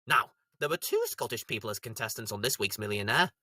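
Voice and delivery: normal talking voice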